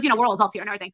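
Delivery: usual speaking voice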